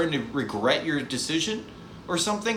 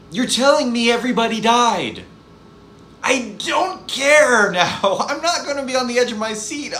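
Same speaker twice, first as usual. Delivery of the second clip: higher and louder, fed-up rant; loud incredulous complaint; exasperated; 8 semitones higher, 6 dB louder than usual. Real, not acted. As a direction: throw up your hands in disbelief.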